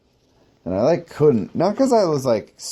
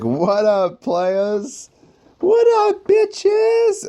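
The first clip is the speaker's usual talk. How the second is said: high, cocky hollering greeting; swaggering entrance; much slower than usual. Retold or imitated. He swaggers into airframes calling out, high and cocky. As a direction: strut in and holler it. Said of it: "I was just like".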